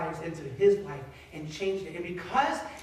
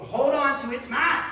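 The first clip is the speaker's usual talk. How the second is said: rising higher and louder to "mine"; possessive mock outburst; 7 semitones higher, 8 dB louder than usual. Preacher mocking greedy dreamers holding on, possessive outburst. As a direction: build to a high, loud, greedy claim.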